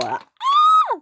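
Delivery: very high piercing scream; shrill high scream. Acted. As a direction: shriek as you're caught. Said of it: "screamed Flora".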